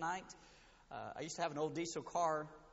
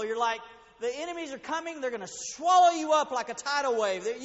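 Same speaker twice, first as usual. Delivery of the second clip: loud, higher urgent warning; preaching at full force; 8 semitones higher, 8 dB louder than usual. Acted. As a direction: paint the danger outside the walls. Loud warning about outside the city.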